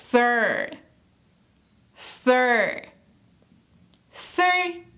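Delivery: "Third" with tongue out, then an r; th plus r. Shown as a demonstration. Teacher building up the sound step by step. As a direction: glide from "th" into the r of "Third". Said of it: "le agregamos el sonido de la R".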